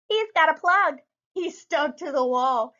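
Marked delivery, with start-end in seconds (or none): 0.1-1.0 mild teasing. Acted. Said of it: "his brothers teased him".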